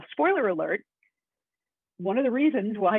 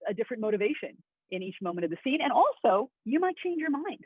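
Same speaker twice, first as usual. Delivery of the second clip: normal talking voice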